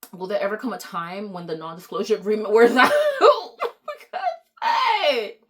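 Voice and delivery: words breaking into high laughter; laughing. Real, not acted. Laughing at her own joke.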